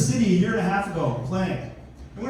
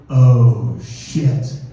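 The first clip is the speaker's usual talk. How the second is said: low, heavy mock realization; dawning realization; 9 semitones lower, 9 dB louder than usual. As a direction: let the awful truth land, deep and loud. Coach realizes his mistake.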